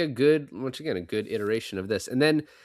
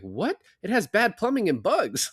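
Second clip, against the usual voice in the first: high, astonished repeat of the complaint; Alvy stunned she gave up a cheap apartment; incredulous echo; 10 semitones higher than usual. Retold or imitated. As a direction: throw her words back in disbelief, pitched way up. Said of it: "He's like".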